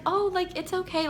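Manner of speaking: high, soft retold reassurance; placating. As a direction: brush off the refusal in a small, high voice. Retold haggling, brushing off refusal.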